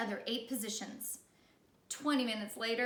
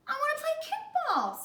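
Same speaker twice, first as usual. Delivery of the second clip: high, loud whine; high whiny child voice; whiny Ron; 6 dB louder than usual. Acted. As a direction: whine it like a little kid. Ron repeating his plea.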